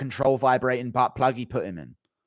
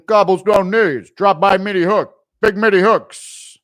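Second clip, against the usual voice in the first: Lucas impression; 6 semitones higher, 10 dB louder than usual. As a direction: drag out the words like a hyped fighter. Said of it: "Lucas impression".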